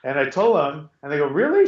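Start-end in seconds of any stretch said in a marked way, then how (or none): 1.3-1.7 high-pitched questioning disbelief; retold surprise. Bouncer hearing his side of the story, surprised disbelief. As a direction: imitate a doubtful bouncer, pitch jumping up.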